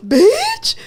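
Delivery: high, loud shocked bitch; telling friends about the old man's offer, shocked blurt; shocked exclamation. Retold or imitated. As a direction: blurt it in disbelief.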